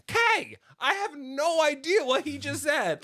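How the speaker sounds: high, emphatic mock exasperation; retold bafflement. Retold exasperated reply to friend.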